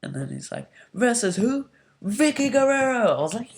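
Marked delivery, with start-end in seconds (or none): 1.0-3.2 much higher, louder big reveal; dramatic announcement. Retold or imitated. McMahon revealing A J's opponent, a booming dramatic reveal. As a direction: build up and drop the name like a showman.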